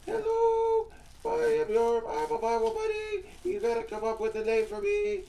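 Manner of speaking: very high squeaky doll voice; high-pitched puppet Susie Q; squeaky girl puppet. Acted. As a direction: play a tiny girly doll. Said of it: "which way this one is now".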